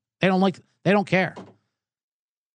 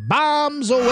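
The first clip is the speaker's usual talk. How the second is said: announcer shout; 10 semitones higher than usual. Acted. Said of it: "first bomb of the week".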